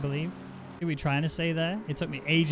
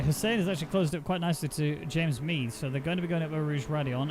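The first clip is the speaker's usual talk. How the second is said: ordinary talk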